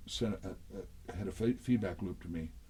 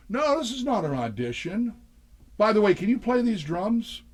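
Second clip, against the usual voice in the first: much higher, louder showman voice; imitated frontman denial; 11 semitones higher, 6 dB louder than usual. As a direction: mimic a singer brushing off the question. Voicing [name]'s breezy denial.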